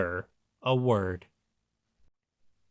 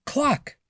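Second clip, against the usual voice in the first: very high cluck; hen cluck; 13 semitones higher than usual. A narrator's reading. Reading to children, a very high hen cluck. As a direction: squeak out a hen's cluck. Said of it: "and the hen said".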